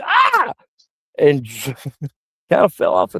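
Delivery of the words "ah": high shocked yelp; startled yelp. Retold or imitated. Stranger on the toilet, yelping in shock. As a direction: jump at the sudden voice.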